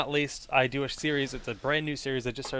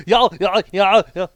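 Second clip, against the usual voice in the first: high, loud garbled yelling; hammed-up yell breaking down; lost control of voice; 6 semitones higher, 13 dB louder than usual. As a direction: voice running away. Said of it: "lost control of his voice".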